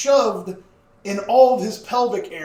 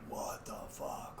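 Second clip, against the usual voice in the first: stunned whisper; 22 dB quieter, slower than usual. Retold or imitated. Imagining a listener's shocked reaction.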